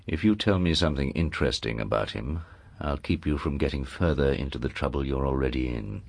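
low, soft, menacing confidential voice; undertone. Acted. Lord to his hidden niece by the wardrobe, in an undertone. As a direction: speak secretly under your breath, pitched well down. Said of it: "spoke in an undertone".

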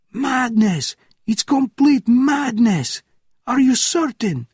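very high, loud officer's scream; panicked scream. A narrator's reading. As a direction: scream it in frantic disbelief. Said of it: "screamed at the top of his lungs".

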